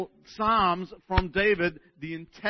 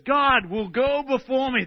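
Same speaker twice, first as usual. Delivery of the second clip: fervent reading; 8 semitones higher, 7 dB louder, slower than usual. Acted. Reading psalm with fervour.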